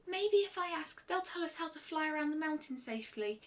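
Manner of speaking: put-on a light touch of a shy young pony; timid Fluttershy. A narrator's reading. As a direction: offer the idea gently, half afraid to speak up. Fluttershy voiced by the narrator.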